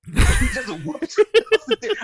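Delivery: high, loud words through laughter; laughing. Real, not acted. A host laughing about Fury punching Theory, laughing. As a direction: laugh out the line.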